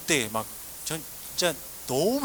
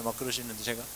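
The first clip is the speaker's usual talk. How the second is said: ordinary talk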